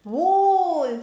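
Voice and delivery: Wool with a long oo. Shown as a demonstration. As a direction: stretch the oo in Wool. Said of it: "Sound is again long".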